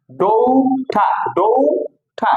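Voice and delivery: ডৌঠা slow and high, "DOU-tha"; corrected pronunciation. Shown as a demonstration. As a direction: model ডৌঠা with long "ou", aspirated th. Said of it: "হচ্ছে ডৌঠা".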